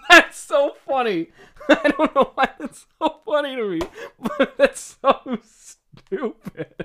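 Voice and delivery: high, loud, slowed by laughter; helpless with laughter; laughing fit. Real, not acted. Laughing fit over the lyric.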